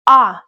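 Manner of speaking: letter A as a clear, isolated "ah"; open "ah" for A. Shown as a demonstration. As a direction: say A as in father. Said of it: "like in father".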